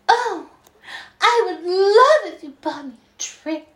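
very high, gushing mock flirt. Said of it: "like, flirting with this guy".

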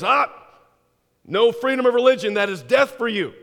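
loud, high barked threat; police threat. Acted. North Korean secret police threatens the American, loud harsh threat. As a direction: slam down the prisoner's claim.

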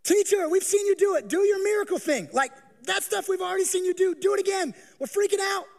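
frantic begging. Acted. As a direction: beg Jesus in a rush of fear. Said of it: "Notice it doesn't say".

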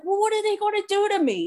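high, fast, worried whine; anxious. Retold or imitated. Scared sister asking her brother about rehab, high and fast.

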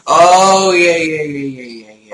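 loud burst of recognition, tailing off; excited recognition. Real, not acted. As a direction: blurt it out as it suddenly comes back to you. Suddenly recognizes the door bug.

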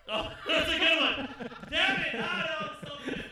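loud laughing through words; cracking up, high and loud; laughing hard. Real, not acted. Cracking up at a joke.

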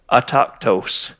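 slow, low "autochthos", dropping in scorn; with disdain. Shown as a demonstration. Pastor condemning the idle faction, with disdain. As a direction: let the word fall with contempt. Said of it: "say it with a little bit of disdain".

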